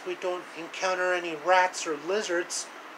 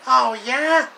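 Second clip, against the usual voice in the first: high, louder taunting "Oh, yeah?"; mock challenge; 9 semitones higher, 8 dB louder than usual. One cartoon hero taunting another, high loud comeback. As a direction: fire back a cocky challenge.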